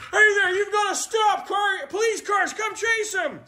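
high urgent shouted commands; calling out orders. Pretend. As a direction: yell across the room to rally the police cars. Calling police cars to chase.

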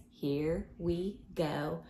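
usual speaking voice

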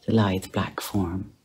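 ordinary delivery, nothing marked

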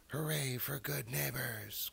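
high, slow, drawn-out cheer; joyful cry. A narrator's reading. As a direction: stretch it into a happy cheer. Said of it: "cried Ratty".

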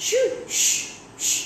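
high shoo, then hushing; sharp little hushes. A narrator's reading. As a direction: shoo away a cat. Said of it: "shooed him as if he was a cat".

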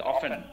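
"অফেন" as off-en, falling; silent T in often. Shown as a demonstration. Teacher repeats often after explaining the mute T. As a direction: say "অফেন" with the T left out. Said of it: "টিটা উচ্চারণ mute থাকে".

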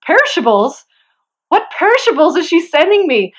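rising, puzzled mock disbelief at the word perishables; baffled surprise. Unpacking the truck when the friend texts, retold baffled surprise. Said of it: "I'm like".

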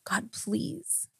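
acted-out whispered begging; pleading prayer. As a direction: whisper it like a desperate private prayer. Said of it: "and I prayed".

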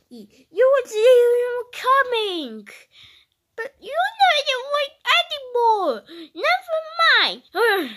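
high, loud wailing protest; dramatic pretend tantrum; acted upset outburst.

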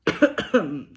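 sudden loud cough from choking. Real, not acted. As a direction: cough hard as food goes down wrong. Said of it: "almost choked".

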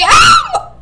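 high loud shriek; high startled scream. Real, not acted. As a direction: shriek in sudden fright. Sudden scream in game.

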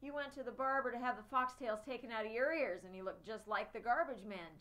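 put-on boy jeering at his friend about his dog. A narrator's reading. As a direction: jeer at him, enjoy the dig. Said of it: "jeered Scooter".

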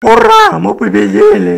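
high, loud shout of victory; triumphant cheer. Acted. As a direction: cheer the win at full voice. (ликующий крик)

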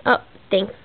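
ordinary talk

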